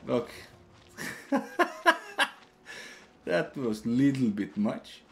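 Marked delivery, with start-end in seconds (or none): 1.0-2.3 high airless relieved laugh; high breathy laugh. Real, not acted. Relieved after escape.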